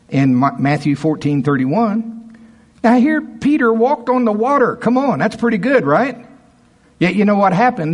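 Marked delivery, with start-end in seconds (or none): 2.8-7.9 high, loud, fervent preaching; preaching at full force. Acted. Pastor rousing his congregation, in full preaching voice. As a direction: lift the church with it.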